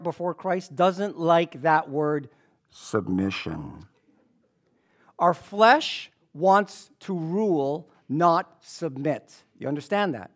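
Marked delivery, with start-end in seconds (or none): 2.7-3.8 ominous word. Acted. Dreaded word, drawn low. 5.2-9.3 slow emphasis. Acted.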